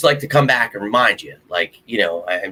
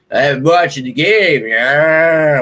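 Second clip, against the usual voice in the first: loud, drawn-out mock fan voice; mocking imitation; 4 semitones higher, 7 dB louder, much slower than usual. Host mimicking fans who claim they watch the game, loud and mocking. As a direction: parody a loudmouth fan, stretched out.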